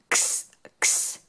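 whispered "X, x" as a breathy ks hiss; x sound. Shown as a demonstration. Teacher to children, a whispered "x" ks sound. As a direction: hiss "x" softly like ks. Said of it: "Do you hear the X".

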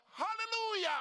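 high, loud hallelujah; preacher crying out in praise; sudden full-voiced jubilant burst; real praise shout. Real, not acted.

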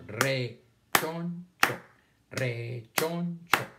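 very slow, rechoncho split into re-chon-cho; sounded out syllable by syllable. Shown as a demonstration. Teacher sounding out a word for pupils, syllable by syllable. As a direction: stretch each syllable apart, then join them. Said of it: "Leer es hacer los sonidos todos pegaditos".

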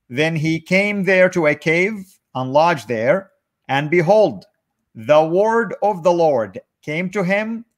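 slow, deliberate scripture reading; measured verse-by-verse read-aloud. Real, not acted. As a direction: read each phrase slowly, pausing between. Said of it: "I want you to read it slowly".

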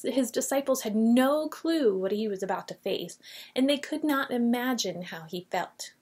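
normal talking voice

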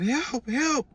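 high cries for help; imitated screaming. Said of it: "he was just screaming".

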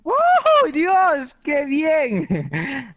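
high, loud whoops of delight; excited cheer. Real, not acted. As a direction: cheer the great shot. Big kill in the match.

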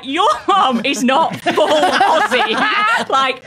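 loud, high, drawn-out incredulous protest; laughing disbelief. Retold or imitated. Girlfriend retelling meeting his mum, loudly incredulous. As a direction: hammer each word out slowly, laughing.

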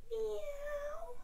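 high crying meow running into the words; cat meow. Shown as a demonstration. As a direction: meow high and stay catlike through the cue. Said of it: "when we breathe out".

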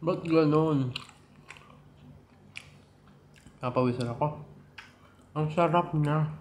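chewing between words; eating while talking; mouth full of chicken. Real, not acted. Seen 2: Bit chicken at -9; keeps holding it, jaw working between phrases.

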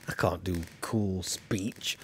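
low, soft and slow, then a whisper on cool; softly admitting he can't be cool; self-deprecating mumble. Real, not acted. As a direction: mutter it, shrinking away. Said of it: "I'm not cool".

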